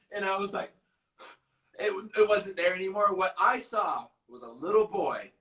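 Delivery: ordinary talk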